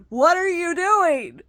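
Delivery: high, loud, slow pretend confrontation; imagined outburst. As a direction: let out what you wanted to say. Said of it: "I wanted so badly".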